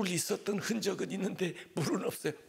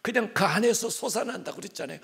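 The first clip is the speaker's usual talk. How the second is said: ordinary delivery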